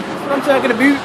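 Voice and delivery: yelled high and loud, stretching about; excited shout. Shown as a demonstration. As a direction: belt it out, rising on about.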